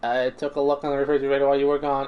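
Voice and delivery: a cheerful cooking robot, sure of himself; robot chef. Acted. Robot admits spoiled tripe.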